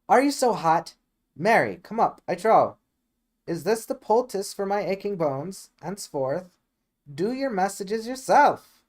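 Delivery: loud, high mock-offended nurse; huffy scolding. The nurse huffily scolding impatient Juliet, loud and high. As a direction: tell her off, all injured pride.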